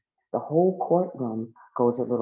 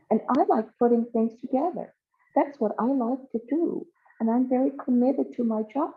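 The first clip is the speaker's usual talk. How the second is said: a higher-pitched, earnest complaining character; lightly put-on higher voice for a fretful character; lightly voiced Connie Conjunction; 9 semitones higher than usual. Connie introduces herself to the court, lightly voiced in higher pitch.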